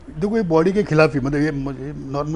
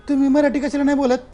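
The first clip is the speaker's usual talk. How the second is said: Marathi principal; 10 semitones higher, 4 dB louder than usual. Retold or imitated. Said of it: "अब principal तो महाराष्ट्र".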